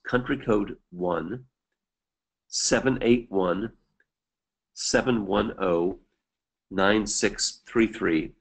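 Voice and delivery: slow, spaced-out digit groups; slowly. Real, not acted. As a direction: read the number out slowly, pausing between groups. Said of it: "I'll repeat it slowly".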